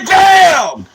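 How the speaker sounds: shouted gunshot sound, high and sharp; retold moment of being shot, shouted bam; loud bam. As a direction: slam out the impact like a gunshot. Retelling the shot hitting him.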